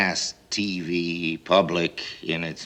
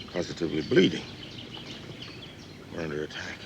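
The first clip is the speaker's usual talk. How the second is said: normal talking voice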